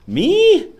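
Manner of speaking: very high, loud squeaky me; mock surprised squeak. Vlogger answering a viewer asking his name, high mock squeal. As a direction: squeal it like a startled kid.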